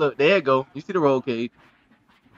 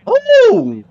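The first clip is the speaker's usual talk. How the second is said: excited ooh; 12 semitones higher than usual. Real, not acted. Reacting to a car jump.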